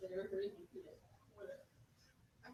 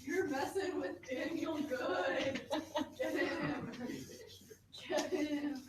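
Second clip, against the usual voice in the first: drawn-out, higher mock menace; mock threat; 4 semitones higher, 3 dB louder, much slower than usual. Students joking around on camera, mock threatening. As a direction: playfully egg someone on.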